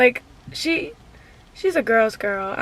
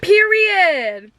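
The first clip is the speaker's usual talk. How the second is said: excited cheer; 6 semitones higher than usual. Retold or imitated. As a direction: cheer it like a fan. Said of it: "I said".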